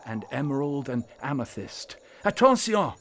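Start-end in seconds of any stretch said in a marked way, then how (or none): 2.2-2.9 high, loud cry of warning; urgent high-pitched warning yell; shout. A narrator's reading. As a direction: shout a sudden alarm, French-style. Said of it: "shouted Klaus".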